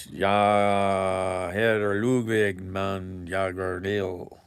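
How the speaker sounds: playing at a Swedish accent for viewers; mock Swedish. Joking to viewers in a loud mock Swedish accent. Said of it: "See, I can speak Swedish".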